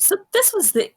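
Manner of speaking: high-pitched in-character outcry; mock shout. Player acting out Yorkia's reaction, high mock shout. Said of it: "she, like, shouts".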